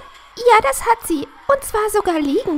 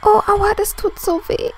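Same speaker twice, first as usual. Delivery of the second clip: pained moan.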